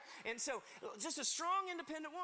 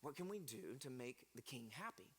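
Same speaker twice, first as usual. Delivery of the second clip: worried advisors; 9 semitones lower, 7 dB quieter than usual. Acted. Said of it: "they're like".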